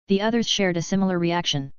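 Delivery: usual speaking voice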